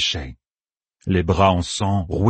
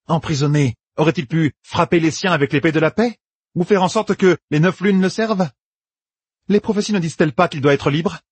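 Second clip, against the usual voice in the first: higher-pitched character voice, pressing rhetorical questions; challenging debater; 9 semitones higher than usual. Acted. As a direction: press each question like an argument. Personnage enchaîne des questions rhétoriques. (ton de contestation insistant)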